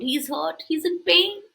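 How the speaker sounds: plain reading voice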